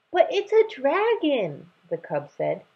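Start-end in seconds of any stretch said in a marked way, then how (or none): 0.1-1.7 put-on high, slow childish protest; protesting cub. Lion cub arguing with his mother, high childish protest. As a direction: whine it like a small cub.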